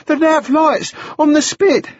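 put-on high, panting boy; breath in gasps. A narrator's reading. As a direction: report it winded, between gasps. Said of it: "his breath was coming in gasps".

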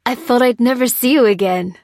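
loud, high cry of reunion; emotional cry. A narrator's reading. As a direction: cry out overjoyed to a lost friend. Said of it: "cried the voice".

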